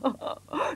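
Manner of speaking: short acted chuckle; doctor's amused little laugh. A narrator's reading. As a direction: chuckle at your own idea. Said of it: "il se mit à rire".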